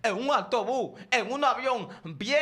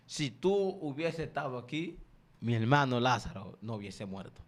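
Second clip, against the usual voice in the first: low, quiet mourning reproach; a grieving sister pleading in a sunken voice; tearful plea; 10 semitones lower, 5 dB quieter than usual. Acted. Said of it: "Y dice la misma pregunta". (reproche doliente, bajo y grave)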